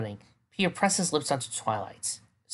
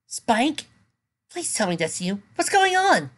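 high, anxious pleading voice; plead, scared and confused; frightened; 11 semitones higher, 4 dB louder, slower than usual. Acted. Frightened character voice.

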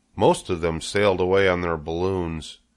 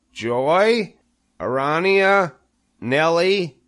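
high, measured roll call; formal speech; 10 semitones higher, 4 dB louder, slower than usual. A narrator's reading. Wilbur opens his speech to the spiders, formally. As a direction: address each name with ceremony. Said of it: "he should make a short speech".

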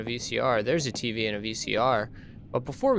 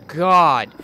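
alarmed; 4 semitones higher, 8 dB louder than usual. Real, not acted. Reacting to a scare.

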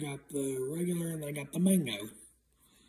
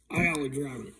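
usual speaking voice

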